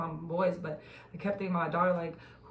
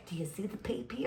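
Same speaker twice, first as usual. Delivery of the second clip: unvoiced teasing whisper; 8 dB quieter than usual. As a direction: lean in and whisper it. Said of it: "teasing whisper to mother".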